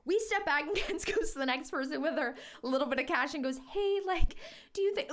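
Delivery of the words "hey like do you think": high, sugary imitation of a friend; imitated super-sweet request. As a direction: sweet-talk a stranger in a raised voice. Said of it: "like, so sweet, like, literally couldn't be sweeter".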